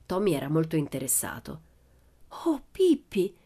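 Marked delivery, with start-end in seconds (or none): 2.4-3.3 high, thrilled call; enraptured cry. A narrator's reading. Tommy watches Pippi make fire, crying out enraptured. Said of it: "gridò rapito".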